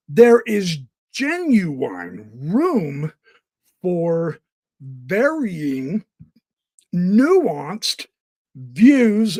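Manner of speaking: drawn-out, deliberate words; slow emphatic. Real, not acted. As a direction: spell it out slowly.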